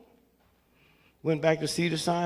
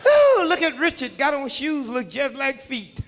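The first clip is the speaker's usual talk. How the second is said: loud, higher ooh of mock praise; mock admiring neighbor; 6 semitones higher, 5 dB louder than usual. Neighbors praising young Richard's Halloween outfit, hammed-up gushing. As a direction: gush like impressed neighbors.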